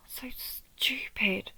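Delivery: slow, breathy self-reproach. Acted. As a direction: drag it out on a whispery breath, blaming yourself. Berating self over mistakes.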